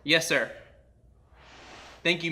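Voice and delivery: ordinary delivery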